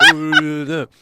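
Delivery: high, mimicked echo with laughter; mock echo on the line; echo imitation. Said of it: "the echo imitation".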